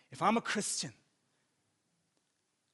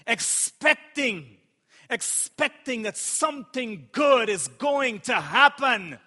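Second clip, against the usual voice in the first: loud, raised, fervent preacher's delivery; full-force preaching; 4 semitones higher, 7 dB louder than usual. Real, not acted. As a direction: preach it out, punching the key words.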